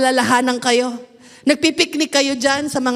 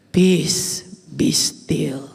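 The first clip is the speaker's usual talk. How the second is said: calm the storm with a hush; hushed command; 11 semitones lower, much slower than usual. Acted. Jesus calming the storm. (mahinang utos na pabulong)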